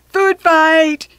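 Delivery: high, loud gleeful shout; loud announcement. A narrator's reading. George announcing a food fight to the cafeteria, shouting. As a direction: call out the food fight to the room. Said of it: "he announced loudly".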